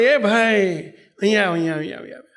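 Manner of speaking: put-on old widow calling out to a passerby. Old woman calls stranger to lift basket, loud call. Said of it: "એમણે બૂમ પાડી".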